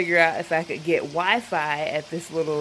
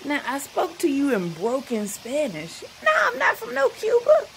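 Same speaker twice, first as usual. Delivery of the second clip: higher, sassy inner voice, rising to an indignant peak; incredulous inner retort; 9 semitones higher than usual. Retold or imitated. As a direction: snap back in your head with disbelief. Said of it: "I'm thinking".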